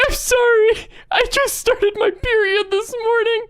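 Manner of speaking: squealing high, tearful imitation; mock sobbing. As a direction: blubber an apology in a fake sob. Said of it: "made her fucking sob uncontrollably".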